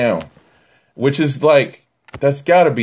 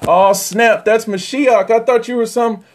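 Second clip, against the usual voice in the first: surprised realization; 8 semitones higher than usual. Retold or imitated. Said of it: "and they're like".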